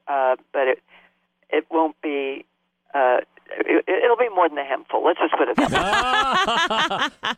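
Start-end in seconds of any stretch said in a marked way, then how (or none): 5.6-7.3 suggestive. Real, not acted. Innuendo about giving fans treat.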